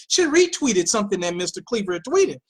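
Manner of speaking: usual speaking voice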